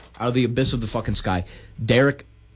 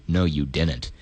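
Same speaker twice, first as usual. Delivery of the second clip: low menacing plumber; 7 semitones lower than usual. Retold or imitated. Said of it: "He's like".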